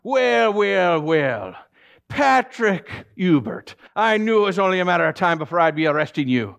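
high, loud gloating cop voice; smug police officer. Retold or imitated. Officer O'Malley gloating over catching Patrick, high and loud. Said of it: "out comes Officer O'Malley".

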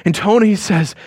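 ordinary delivery

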